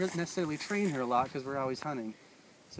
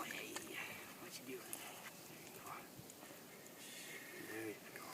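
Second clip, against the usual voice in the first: much softer murmured praise; soothing the dog; 2 semitones lower, 18 dB quieter than usual. Real, not acted. Trainer gently calming the dog in his hands. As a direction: hush it right into her ear.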